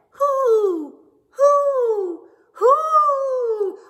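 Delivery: loud high hoo repeated; owl hoots. Acted. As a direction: hoot three times like an owl. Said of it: "Let me try it again".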